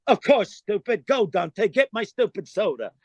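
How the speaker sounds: light impression of a brash comic; mock bossy star dismissing his lackey; Andrew Dice Clay. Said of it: "it's me playing Dice".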